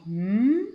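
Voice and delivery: m as a held "mmm" hum; letter sound. Shown as a demonstration. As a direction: hum the m on its own. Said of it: "la lettre qui chante". (m chanté, « mmm » prolongé)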